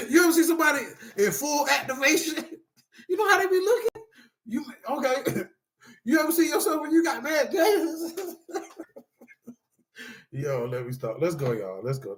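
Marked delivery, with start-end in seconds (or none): 0.1-8.1 high-pitched words squeezed through laughter; can barely talk for laughing. Real, not acted. Speaker to the group, cracking up mid-sentence.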